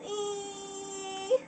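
high, held "ee" of a pleading filly; drawn-out begging whine. Acted. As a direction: keep whining one high vowel.

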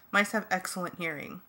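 plain reading voice

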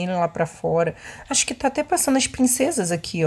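normal talking voice

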